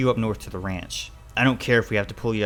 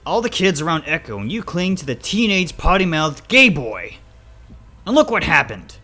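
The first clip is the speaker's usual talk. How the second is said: loud, higher, outraged father; angry rant; 8 semitones higher, 11 dB louder than usual. Acted. Father condemning child's choice of friend, angry. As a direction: berate the child forcefully.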